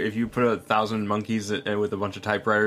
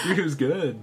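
giddy; 6 semitones higher than usual. Real, not acted. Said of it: "You sound very giddy about it".

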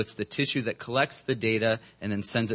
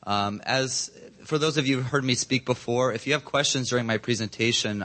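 ordinary talk, nothing marked

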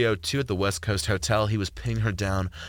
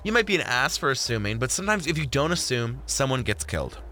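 higher, animated woman's voice; Jordan, insistent; 5 semitones higher than usual. Acted. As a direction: argue the point with playful conviction.

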